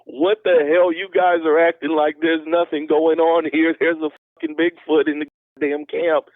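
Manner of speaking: high, panicky imitation; mock a friend losing his cool; freaking out. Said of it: "he's absolutely losing his shit".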